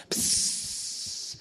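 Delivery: long sharp "psst" hiss; imitated hissing noise. Man imitating a noise heard while marching, hissing. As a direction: imitate gas spraying out. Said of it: "I hear this noise".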